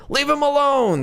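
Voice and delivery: high mock yell of an angry father; father screaming. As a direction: imitate the dad shrieking from another room. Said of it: "hear screaming in the background and go".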